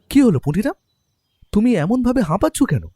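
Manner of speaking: alarmed to see him panting; concerned question. Acted. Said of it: "আমি ওকে বললাম".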